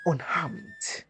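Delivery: ordinary delivery, nothing marked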